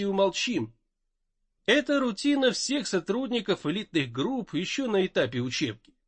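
usual speaking voice

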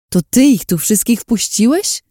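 high, incredulous outburst. A narrator's reading. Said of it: "podniosłam głos". (podniesiony głos, niedowierzanie)

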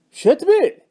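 high, loud incredulous shout; shouted question. Acted. Friend yelling at the trader for selling, shouting. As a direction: yell it in disbelief. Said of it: "صاح علي".

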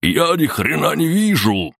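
high-pitched, strained cry; panicked yell. A narrator's reading. Cannon suddenly blinded in the fight, yelling out. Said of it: "закричал Каннон".

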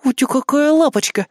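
put-on high-pitched baby-talk cooing; teasing cooing. A narrator's reading. Kri teasing the raging major general, cooing. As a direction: coo mockingly as at a baby. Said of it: "заулюлюкала Кри".

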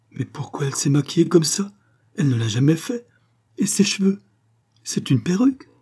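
mother baffled by the makeup, pleading; bewildered exclamation. Acted. Mother at the body, distressed bewilderment. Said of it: "s'exclama-t-elle".

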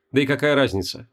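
ordinary delivery

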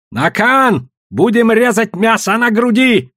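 high, forceful cry; savage shouted command. A narrator's reading. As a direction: shout the grim order. Winner drags loser off.

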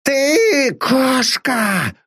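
slow, high, sickly hiss. Acted. Master hisses at his servant in a sickly voice. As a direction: spit the insult out slowly. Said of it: "прошипел он болезненным голосом".

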